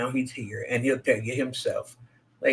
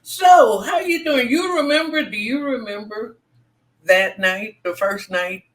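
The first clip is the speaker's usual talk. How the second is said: excited; 9 semitones higher, 5 dB louder than usual. Real, not acted. Host excitedly greets old friend.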